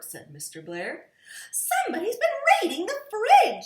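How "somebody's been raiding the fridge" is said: much higher, louder, fast and tearful; wailing mother voice. A narrator's reading. Missus Blair discovering the raided fridge, wailing high. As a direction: wail it out in mock distress. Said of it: "said Missus Blair".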